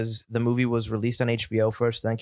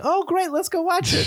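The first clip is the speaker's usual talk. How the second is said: much higher, eager wife voice; mock eager wife. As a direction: gush like a thrilled wife. Mock wife agreeing to movie.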